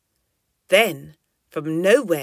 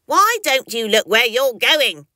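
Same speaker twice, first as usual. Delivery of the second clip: louder, higher grumbling complaint; angry bat; 6 semitones higher, 8 dB louder than usual. A narrator's reading. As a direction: snap at the clumsy flyer. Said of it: "complained the angry bat".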